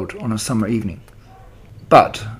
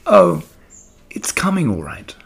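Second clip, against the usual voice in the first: lightly; 8 semitones higher than usual. Acted. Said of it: "she said lightly".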